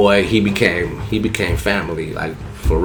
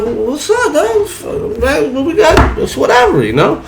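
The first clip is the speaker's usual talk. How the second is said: high, laughing bring-it-on; mock tough while laughing; laughing taunt to fight; laughing challenge; 3 dB louder, slower than usual. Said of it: "I was like".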